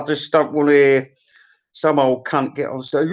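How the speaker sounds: ordinary talk, nothing marked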